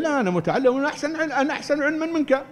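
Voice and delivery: high, quick boast of an arrogant learner; conceited know-it-all. Acted. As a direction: brush off correction with puffed-up self-importance. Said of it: "عنده غرور".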